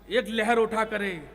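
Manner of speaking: usual speaking voice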